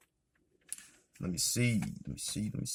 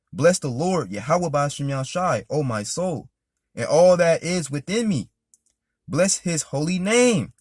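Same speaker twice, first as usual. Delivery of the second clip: scripture reading; 3 semitones higher, slower than usual. Acted. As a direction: proclaim the psalm with fervor. Reads psalm aloud, preaching.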